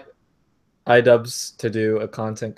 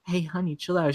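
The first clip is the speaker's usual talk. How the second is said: softer, higher mock pleading; imitated girlfriend; 6 semitones higher, 7 dB quieter than usual. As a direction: lightly voice a girlfriend soothing him. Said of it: "she's like".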